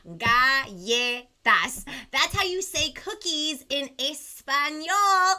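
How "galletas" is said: Galletas very slow, ll said as "ya"; slow model word. Shown as a demonstration. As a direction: draw out Galletas with ll as "ya". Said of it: "it's pronounced like a ya".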